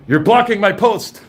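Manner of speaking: laughing high mimicry of the guard; mocking the guard. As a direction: parrot his words, high and laughing.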